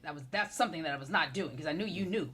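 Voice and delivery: normal talking voice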